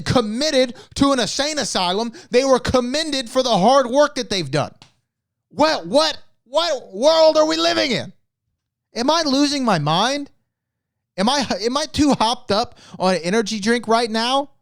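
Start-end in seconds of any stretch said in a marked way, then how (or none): 5.5-13.1 incredulous outburst, voice climbing; getting carried away with disbelief; too emotional. Real, not acted. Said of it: "I'm getting a little bit too emotional".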